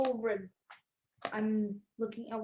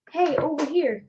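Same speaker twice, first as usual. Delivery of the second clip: calling out to be heard across town; called out; 4 semitones higher, 7 dB louder than usual. Pretend. Player calling out to unseen people in town, loud call. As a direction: shout it to the people up above. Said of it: "yell just".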